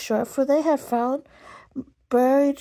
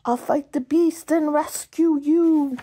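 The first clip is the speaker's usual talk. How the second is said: heroic knight; 7 semitones higher, 5 dB louder than usual. Acted. Hero play-acting.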